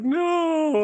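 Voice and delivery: long, high mock-anguished cry; drawn-out retold cry of dismay; devastated wail. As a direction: wail it in exaggerated grief. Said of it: "I was devastated when she died".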